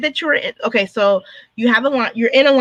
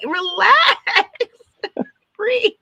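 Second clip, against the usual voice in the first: much higher, laughing through relax, breathe; laughing reassurance; 10 semitones higher, much slower than usual. Retold or imitated. Designer calming a panicked client, high and laughing. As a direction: soothe them while cracking up.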